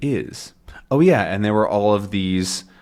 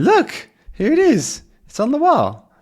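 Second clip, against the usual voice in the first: high, louder acted excitement; excited discovery; 5 dB louder than usual. Character pointing out whiskey on the wall, excited. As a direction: exclaim as if just spotting it.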